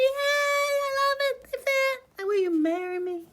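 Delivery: overjoyed princess proposing; excited. Acted. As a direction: gush with joy. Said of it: "She's all excited".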